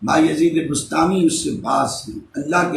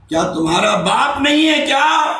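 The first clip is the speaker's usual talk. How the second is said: raised, high-pitched insistent question; urgent questioning; 10 semitones higher, 4 dB louder than usual. Acted. Saint asking a crying boy about his father, urgent. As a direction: press the question with a preacher's force.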